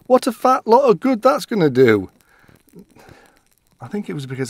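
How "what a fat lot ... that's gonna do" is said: higher, louder, amused wife's retort; wife's laughing quip. Retold or imitated. Wife laughing off her husband's offer of toilet roll, amused. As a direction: toss it off with a laugh. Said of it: "she laughed and said".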